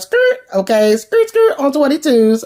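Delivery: high-pitched imitated tire skids; sassy mock screech. Rock stopping Cartier as he moves in, sassy mock screech. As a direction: squeal the skids high, playfully putting on the brakes.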